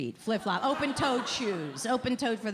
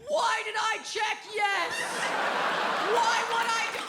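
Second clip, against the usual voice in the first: mock outrage; 11 semitones higher than usual. Spy mocking himself for ticking yes. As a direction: wail it at the heavens in mock despair.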